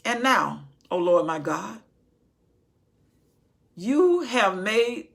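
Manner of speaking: loud, drawn-out preacher's cry; booming sermon delivery; preaching Solomon's prayer. Acted. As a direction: call it out like a pulpit prayer.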